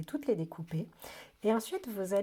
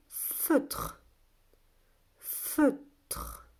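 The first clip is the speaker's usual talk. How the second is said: stretched, very deliberate word; slow sounding-out; 4 semitones higher than usual. Shown as a demonstration. Teacher asks whether A is heard, slow sounding-out. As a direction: draw out each sound for listeners.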